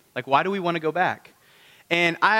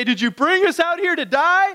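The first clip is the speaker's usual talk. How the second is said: high, complaining mock cry; panicked whining crowd; whiny Israelites; 11 semitones higher, 4 dB louder than usual. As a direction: whine it up high like scared complainers. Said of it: "They say".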